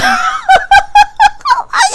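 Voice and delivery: high squealing burst of laughter; shrieking laugh. Real, not acted.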